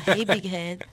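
teasing fake flirty guy voice; laughing imitation of a guy's text; mock texting guy. (imitation moqueuse d'un gars qui texte)

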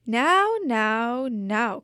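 lightly voiced soothing cat; cat calming the crying girl; purring cat. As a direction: coax her gently like a purring cat. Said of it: "purrs her cat".

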